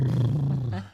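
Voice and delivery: bursting out laughing; a big, sudden laugh; loud laugh. Real, not acted. Reacting to a viewer's chat message.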